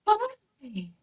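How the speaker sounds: put-on very high, cooing baby talk; talking to a puppy. Shown as a demonstration. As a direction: coo at an adorable puppy. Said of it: "I mostly have them talk to pets or babies".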